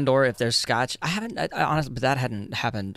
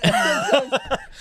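laughing; 4 dB louder than usual. Real, not acted. Laugh after denial.

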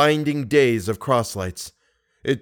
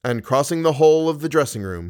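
plain reading voice

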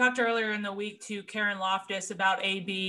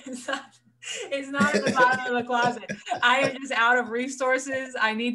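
laughing through the words; giggling while denying it; 5 semitones higher, 3 dB louder than usual. Real, not acted. Laughing at closet joke.

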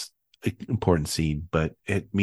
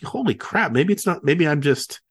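higher, stunned disbelief; amazed; 8 semitones higher than usual. Retold or imitated. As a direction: blurt it out amazed. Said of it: "I'm like".

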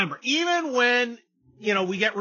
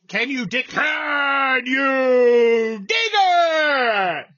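hype shout; 4 semitones higher, 6 dB louder, much slower than usual. Acted. Hyping the crowd.

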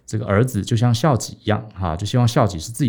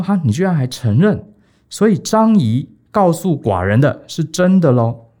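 raised, louder outraged king; angry king; 5 semitones higher, 4 dB louder than usual. Acted. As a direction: let the king flare up in disbelief. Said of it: "然后又大怒".

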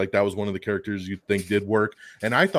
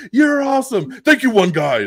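high, loud hammed-up Venom voice at the rave; mock Venom at rave; 12 semitones higher, 7 dB louder than usual. Venom thanking a fan at the rave, high and hammed-up. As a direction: squeak it out like a delighted monster.

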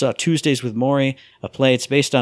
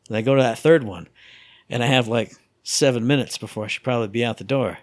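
ordinary delivery

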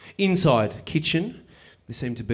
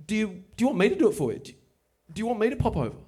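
eager offer; 6 semitones higher, faster than usual. Retold or imitated. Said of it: "I just say".

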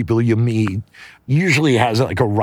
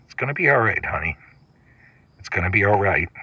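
soft, hushed reassurance; tender comfort; 3 semitones lower, 3 dB quieter, faster than usual. Acted. Ben hugging sobbing Cindy on the floor, hushed and tender. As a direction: soothe her gently, almost whispering. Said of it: "in bed with Ben".

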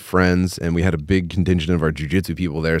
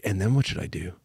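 soft, worried inner voice; anxious inner loop; 7 dB quieter than usual. Retold or imitated. In his head at the rehearsal, a soft worried loop. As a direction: murmur it to yourself, fretting. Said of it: "in my head, I was going like".

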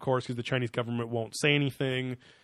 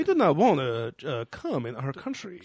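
mock Japanese accent. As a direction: put on a bad Japanese accent. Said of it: "as I'm doing Japanese accent for Chinese people".

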